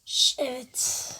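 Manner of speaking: hushed whisper. Real, not acted. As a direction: answer under the breath. Whispered answer during play. (fısıltıyla)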